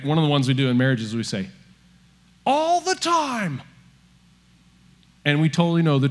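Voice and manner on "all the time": high, drawn-out hammed-up wail; exaggerated accusation. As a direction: inflate it wildly, high and stretched. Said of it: "am I kind of inflating it".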